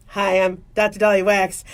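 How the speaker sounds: low, composed professional introduction; serious doctor mode. Shown as a demonstration. Doctor introducing herself to telemedicine patient, serious low voice. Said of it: "doctor mode, you know, serious, you know, telemedicine mode".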